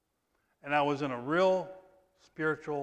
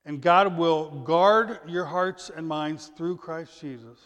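slow, weighty, deliberate reading; preacher reads the blessing slowly and loud; solemn scripture reading; 2 semitones higher, 6 dB louder, slower than usual. Acted. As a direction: lay each word down slowly.

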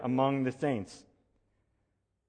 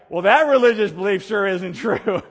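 loud, higher, laughing through the line; laughing pastor; 8 semitones higher, 11 dB louder than usual. Retold or imitated. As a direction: deliver the punchline laughing. Pastor's punchline in a story.

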